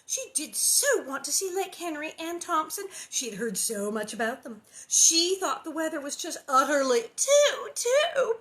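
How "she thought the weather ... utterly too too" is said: put-on high, loud gushing girl voice; gushing Nellie. Nellie prattles to Laura on the drive, high and loud. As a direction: gush on and on.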